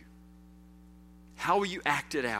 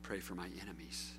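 quiet quote; 11 semitones lower, 18 dB quieter than usual. Retold or imitated. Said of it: "But then she says".

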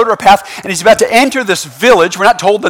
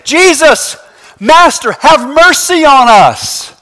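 high, stretched-out plea; raised voices; 6 semitones higher, 4 dB louder, much slower than usual. Acted. Lepers calling to Jesus from afar, raised voices. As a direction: call out for mercy across a distance. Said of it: "They raise their voices saying".

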